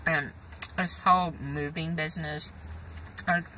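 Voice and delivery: talking between bites; chewing while talking. Real, not acted. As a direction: mouth partly full of food. Seen 3: bit pizza at -2.0; mouth still working, pizza held.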